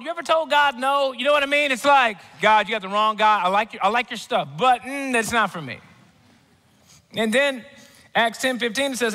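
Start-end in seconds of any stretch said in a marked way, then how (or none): 2.4-5.8 mock refusal to God. Preacher voices someone telling God no.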